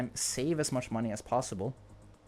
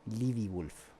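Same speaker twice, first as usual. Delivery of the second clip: usual speaking voice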